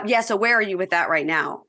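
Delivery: usual speaking voice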